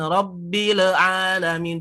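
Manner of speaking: rabbil with an echoing bounce on the ba; the wrong way, with a bounce after rabbil; a wrong demo with a bounce on the ba; bouncing echo. Shown as a demonstration. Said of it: "Jadi jangan ada pantulan seperti itu".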